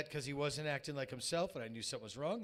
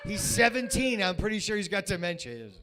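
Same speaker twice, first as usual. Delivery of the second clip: loud, high protest to the vet; exasperated protest; 5 semitones higher, 11 dB louder than usual. Retold or imitated. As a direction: plead loudly that the dog is just old. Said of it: "I was like".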